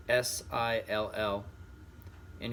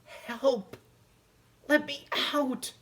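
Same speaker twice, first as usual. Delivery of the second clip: much higher, muffled panicked cries; cry for help; 12 semitones higher, slower than usual. Acted. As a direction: call out trapped and scared. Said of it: "cried Stuart".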